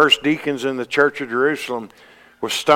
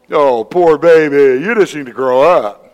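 mocking; 4 semitones higher, 8 dB louder than usual. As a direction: drag it out to belittle them. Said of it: "have 'em mock you".

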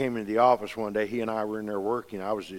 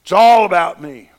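loud mocking echo of a self-centered gospel; 6 semitones higher, 16 dB louder than usual. Preacher scorning the all-about-me gospel, loud and mocking. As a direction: throw out the slogan with scorn.

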